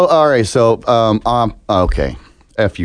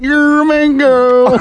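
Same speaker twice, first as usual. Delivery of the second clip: mock deaf kid voice; 3 dB louder, slower than usual. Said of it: "and I'm like".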